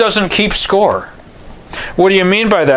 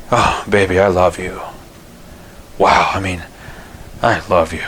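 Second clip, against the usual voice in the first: low, soft, tender murmur to his wife; tender, loving; 9 semitones lower, 5 dB quieter than usual. Shown as a demonstration. Husband looking at his wife, low tender murmur. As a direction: croon it softly like a man in love. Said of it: "looked at my wife and say".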